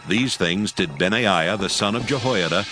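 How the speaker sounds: ordinary talk, nothing marked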